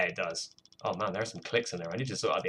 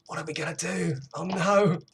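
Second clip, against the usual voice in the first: gasping, high-pitched mock panic; gasp, then wail it high in hammed-up despair; mock desperate; 9 semitones higher than usual. Producer sending up their own desperation, mock panicked. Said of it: "that sounded more desperate".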